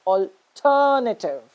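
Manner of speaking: alternative as al-TER-native, TER drawn out; stress on second syllable. Shown as a demonstration. Teacher giving the corrected pronunciation, alternative as al-TER-native. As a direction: lean hard on TER in alternative, reduce the rest. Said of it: "stress the second syllable".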